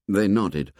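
plain reading voice

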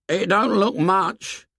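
put-on high, slow, doubtful boy; a worried boy squinting to see; Piggy. A narrator's reading. Piggy doubting the distant ship, high and slow.

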